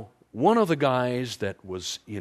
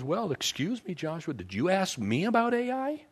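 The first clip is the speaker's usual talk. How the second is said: sarcastic God; 7 semitones higher than usual. Retold or imitated. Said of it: "And God says".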